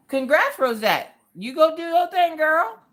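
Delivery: high, loud congratulations; whooping, rising hype; excited cheering. Real, not acted. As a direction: cheer a friend on, pitch climbing high. Cheering on a viewer's news.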